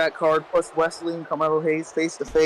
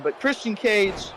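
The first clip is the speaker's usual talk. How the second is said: ordinary delivery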